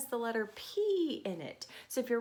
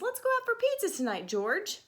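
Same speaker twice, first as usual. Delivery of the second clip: much higher, quick friendly invitation; friend's voice; 10 semitones higher than usual. Acted. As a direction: chirp the invite like an eager friend. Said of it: "said his friend".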